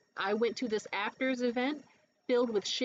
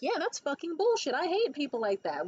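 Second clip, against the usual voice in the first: high, emphatic agreeing; emphatic agreement; 6 semitones higher than usual. Retold or imitated. Friend agreeing.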